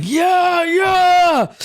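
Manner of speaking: high, loud cheering yell; a roaring crowd of orcs shouting yeah. Retold or imitated. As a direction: whoop it like a cheering tribe.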